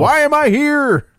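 very high, slow, loud puzzled dog voice; be the old dog, lost and baffled; confused dog voice. Pretend.

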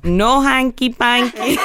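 slow, laughing Indian accent imitation. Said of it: "used to say in an Indian accent".

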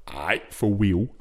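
slow, drawn-out catchphrase in a put-on voice; lightly voiced mock street-talk character; Ali G. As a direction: stretch it out like a comic catchphrase. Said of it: "who is it that does Ali G?".

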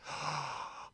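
sharp gasp of discovery; shocked gasp. Acted. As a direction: gasp in mock amazement. Discovers the shop is a front.

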